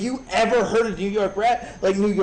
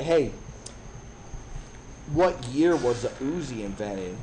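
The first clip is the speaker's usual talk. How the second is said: playing it cool; 6 semitones lower, 4 dB quieter than usual. Playing it off to a date.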